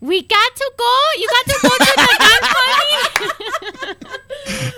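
loud, very high mock Mexican woman; squeaky Mexican accent, breaking into laughter; attempted Mexican accent. Shown as a demonstration. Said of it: "I wanna hear you try it".

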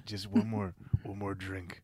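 low, quiet pushy drinking buddy; low coaxing voice. Pretend. Acted coaxing another drink.

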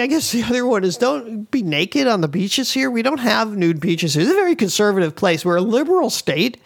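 ordinary delivery

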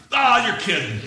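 high, loud imitated scoff; mock disbelief. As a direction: react loudly as a disbelieving friend. Friends scoff at his calling.

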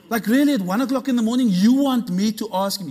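can't-believe-it questioning; incredulous. Retold or imitated. Incredulous reply to God's request.

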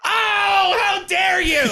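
high shouted how dare you; hammed-up indignant cry; mock outrage. Mock outrage at friend's pick.

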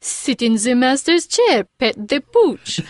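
mocking fake French accent; teasing, exaggerated French accent. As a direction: imitate a Frenchman with a put-on accent. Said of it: "like, fake French accent".

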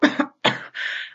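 sputtering cough from a burning drink; harsh cough. Acted. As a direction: choke on a strong swig. Coughs after rocket fuel drink.

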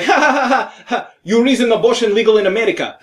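mock laugh, then quick fake Russian accent; comic's Russian-guy impression; Robin Williams. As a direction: laugh along, then fire back as a Russian. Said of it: "pretending to be a Russian guy".

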